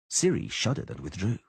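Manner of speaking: ordinary delivery, nothing marked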